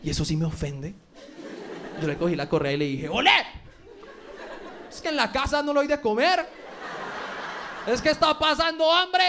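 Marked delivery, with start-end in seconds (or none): 3.1-3.3 sharp mom call to the dog; scolding mom. Acted. Owner tugging the leash, scolding like a mom. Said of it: "ahora digo frases de mamá". 4.9-9.2 higher, indignant motherly nagging; scolding mom. Acted. Owner scolding dog for eating off the floor, indignant mom. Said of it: "ahora digo frases de mamá".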